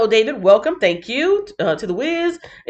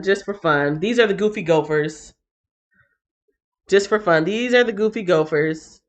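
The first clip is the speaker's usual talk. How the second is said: normal talking voice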